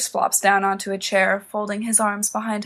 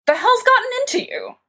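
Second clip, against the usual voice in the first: much higher, louder flushed retort; angry demand; 5 dB louder than usual. A narrator's reading. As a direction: demand an answer in sudden anger. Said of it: "Sirius demands".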